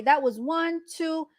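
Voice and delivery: usual speaking voice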